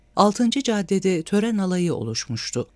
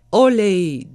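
loud, higher-pitched cheer; cheering shout; 4 semitones higher, 7 dB louder than usual. A narrator's reading. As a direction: call it out like a supporter from the pavement. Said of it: "diye bağırarak manevi destekte". (yüksek sesle tezahürat)

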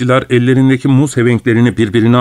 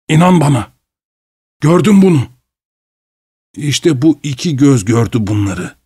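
halting, fevered telling in fits; broken feverish speech. Acted. Fevered Goodbody tells the traveller about the boneless, halting. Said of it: "Kesik kesik konuşuyordu".

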